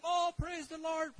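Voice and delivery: high, loud and slow; joyful praise. Retold or imitated. Blessed pastor shouting praise, high and slow. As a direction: lift it up in praise.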